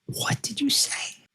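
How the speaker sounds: high, slow, breathy mock-shocked "What did you say?"; hammed-up movie outrage, drawn out; Will Ferrell in Anchorman. Father quoting a movie line at his daughter, mock outrage. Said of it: "Will Ferrell in Anchorman".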